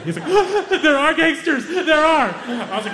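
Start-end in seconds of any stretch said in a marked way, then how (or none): 0.3-0.8 sharp, high gasp of fright; imitating a panicked gasp. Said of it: "Hamza is hyperventilating". 0.8-2.3 panicked. Retold or imitated. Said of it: "Hamza is hyperventilating".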